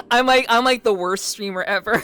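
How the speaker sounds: helpless real laughter through the words; laughing. Real, not acted. Streamer laughing at their own once-a-week schedule, cracking up.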